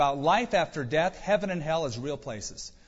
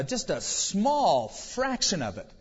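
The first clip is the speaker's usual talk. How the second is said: ordinary talk, nothing marked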